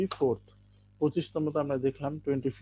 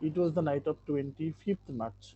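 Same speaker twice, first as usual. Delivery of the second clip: very slow again but softer, each word spaced; slow repeat, softer; 4 dB quieter, much slower than usual. Shown as a demonstration. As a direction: repeat it slowly and more gently. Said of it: "প্রথম লাইনটা ছিল যে".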